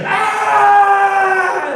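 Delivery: put-on sudden high shriek of a startled boy; mock kid scream. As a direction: yelp like a kid knocked flat. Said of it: "And he's like".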